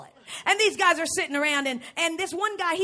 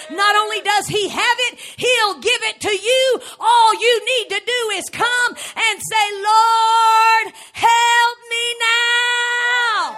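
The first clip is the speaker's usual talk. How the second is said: rising, louder preacher's cry; preaching at full force; 6 semitones higher, 8 dB louder, slower than usual. Acted. Preacher crying out to the congregation, building loud. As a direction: build the sermon to a shout.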